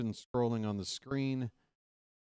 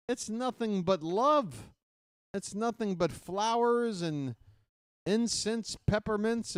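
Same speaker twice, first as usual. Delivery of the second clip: mock sweetness; 8 semitones higher than usual. Mocking chat's love after a win.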